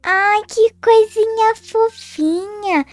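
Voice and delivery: high, slow cooing; baby cooing. Acted. Girl holding the baby, slow high coo.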